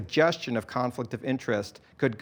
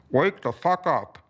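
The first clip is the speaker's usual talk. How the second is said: louder megaphone blast; bullhorn shout; 3 semitones higher, 3 dB louder than usual. A narrator's reading. Alex yells at the crowd through his bullhorn. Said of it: "Alex shouted into his bullhorn".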